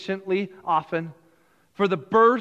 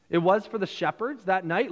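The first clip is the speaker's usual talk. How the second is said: usual speaking voice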